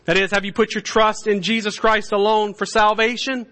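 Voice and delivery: high, loud revival preaching; preaching at full force. Acted. Preacher rallying the faithful, at full force. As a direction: thunder it out over the congregation.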